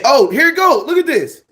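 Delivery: sudden high excited burst. Real, not acted. Streamer showing fan notes, suddenly thrilled. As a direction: jump up in pitch, thrilled to show it.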